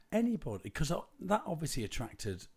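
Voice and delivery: high, loud, emphatic; excited. Real, not acted. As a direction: push the point hard. Excited aside.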